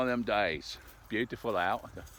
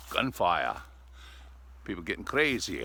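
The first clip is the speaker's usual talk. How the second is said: usual speaking voice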